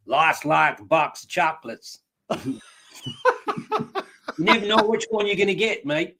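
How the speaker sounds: a hint of Australian, badly faked; bad Australian accent. Host dares him to do it, failed Australian accent. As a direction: have a half-hearted go at an Australian accent. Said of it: "Say it in an Australian accent".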